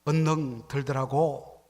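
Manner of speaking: slow, drawn-out Jeolla Korean; lightly done Jeolla drawl; Jeolla dialect. Shown as a demonstration. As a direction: say it like a Jeolla local, unhurried. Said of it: "전라도 사람들은".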